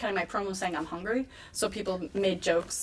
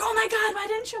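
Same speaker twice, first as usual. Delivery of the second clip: high-pitched excited mock exclamation; exaggerated high quote of an exasperated mentor; Al Snow; 14 semitones higher than usual. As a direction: squeal the question in disbelief. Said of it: "And Al Snow's like".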